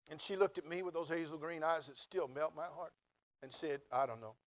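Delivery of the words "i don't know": flat, lower and indifferent; noncommittal shrug. Retold or imitated. Wife answering the proposal with a shrug, indifferent. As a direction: shrug it off, not caring. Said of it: "that's exactly how she said it".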